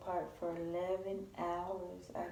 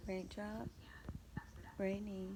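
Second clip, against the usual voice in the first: whispering; 10 dB quieter than usual. Real, not acted. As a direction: whisper so she stays asleep. Early morning whisper.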